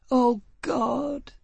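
high, anguished cry of the angel; heart-rending cry. A narrator's reading. Angel crying out to Foyle in a dream, anguished. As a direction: cry out in despair from far away. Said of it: "in a heart-rending descending note".

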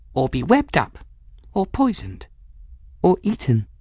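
high, small frightened voice. A narrator's reading. Folly dreading the lair, small worried voice. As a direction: list the dangers in a small high voice. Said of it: "Folly said in a small voice".

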